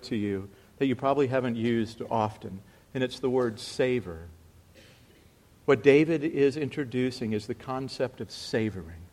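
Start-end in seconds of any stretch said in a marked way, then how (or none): none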